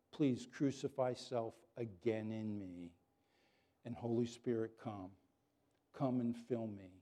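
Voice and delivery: soft, pleading prayer under the breath; quiet prayer. Retold or imitated. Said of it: "I have to say".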